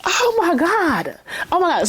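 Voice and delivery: stunned, high-pitched exclamation; real shocked excitement. Real, not acted. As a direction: react breathlessly to a thrilling twist. Reacting to the shooting scene.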